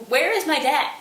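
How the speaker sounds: high, loud where is my dad; angry demand. Retold or imitated. Laertes to the king, loud angry demand. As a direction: demand it furiously.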